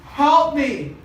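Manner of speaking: imitated tearful prayer, no real sobs; crying plea. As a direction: plead upward as if praying in tears. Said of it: "And he's crying".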